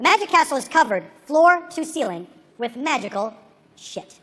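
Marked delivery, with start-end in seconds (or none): none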